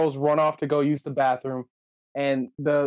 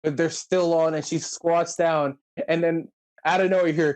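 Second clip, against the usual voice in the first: ordinary talk, nothing marked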